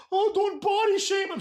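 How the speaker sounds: very high, whiny mock outrage; mocking objector. Fan scolding him for body shaming, mocking high voice. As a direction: squeal it like an offended fan. Mimics objectors, then says shut up.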